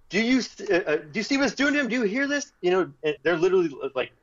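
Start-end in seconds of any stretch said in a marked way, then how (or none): 0.1-2.5 high, insistent questions; pressing friends to notice the crying guy; urgent pleading. Retold or imitated. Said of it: "I'm going like".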